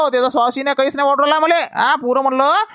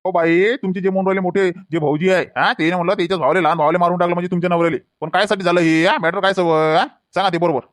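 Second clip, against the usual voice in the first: a calm officer setting a scared woman at ease, lower; police inspector; 6 semitones lower than usual. Acted. Inspector calming the widow at the station, lower and soothing.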